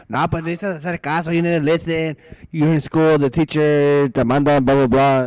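loud imitation of a nagging parent; mock parent lecture. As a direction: lecture loudly, as a lightly voiced parent.

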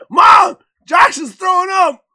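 high, loud shout across the house; calling out to mom. Retold or imitated. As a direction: imitate a kid yelling for a parent. Child calls out to mom.

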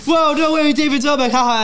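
high, loud hammed-up fake excitement. Seniors on the bus faking a celebrity sighting, hammed-up. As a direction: overact the surprise as a prank. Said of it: "they're like".

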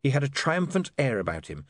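plain reading voice

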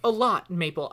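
loud, high, stammering frustration; overwhelmed outburst. Acted. Maple One gives up explaining to Maple, overwhelmed outburst. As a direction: blow up, stumbling over broken phrases.

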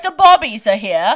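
put-on British accent with laughter in it. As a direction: imitate a woman suddenly talking British. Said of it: "speaking in a British accent".

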